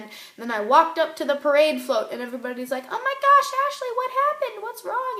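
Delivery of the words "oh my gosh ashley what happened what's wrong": much higher, loud, alarmed friends; worried classmates. Retold or imitated. Friends at the float seeing her crying, high alarmed voices. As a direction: gasp it out like startled worried friends.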